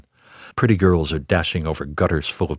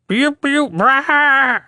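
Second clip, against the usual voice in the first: very high, louder crowing screech; rooster scream; 6 dB louder, much slower than usual. A narrator's reading. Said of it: "He screams".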